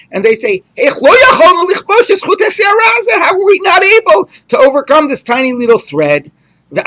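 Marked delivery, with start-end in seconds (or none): 0.7-6.3 much higher, louder crying shout; the wicked crying out over a thread; wailing cry. Retold or imitated. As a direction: sob it out in loud despair. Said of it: "and the wicked go and cry".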